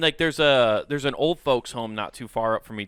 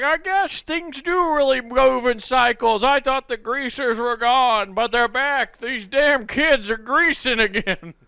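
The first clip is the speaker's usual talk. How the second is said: put-on high, loud old-timer complaining; grumbling old folks; 9 semitones higher, 4 dB louder than usual. Old neighbors complain about the greasers, high and loud. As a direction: grumble like a weary old man about kids. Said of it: "old people are like".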